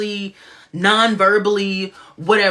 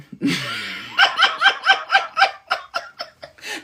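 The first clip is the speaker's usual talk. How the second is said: high breathless laugh at oneself; long, high-pitched giggle. Real, not acted. Host laughing at own muddled talk, high giggle.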